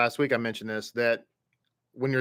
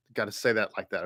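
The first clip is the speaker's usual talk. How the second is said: ordinary talk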